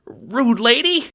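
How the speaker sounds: high, slow squeaky insult; feeble insult. Acted. Little guard fumbles an insult at the Seer. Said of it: "struggles for an insult".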